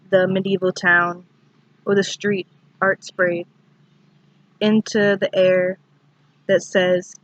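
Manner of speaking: measured recital with long pauses between lines; poetry reading. Acted. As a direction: read the poem in short, deliberate phrases. Recites a poem.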